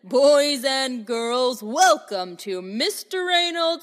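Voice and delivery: slow, grand ringmaster announcement; booming ringmaster. Acted. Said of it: "Mister Reynolds' booming voice".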